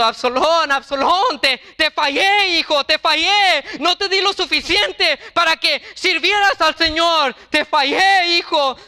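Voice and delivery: father clutching his dead son, wailing in grief; weeping, crying out. A narrator's reading. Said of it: "llorando y diciendo".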